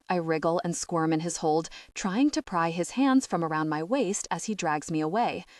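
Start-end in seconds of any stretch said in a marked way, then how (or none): none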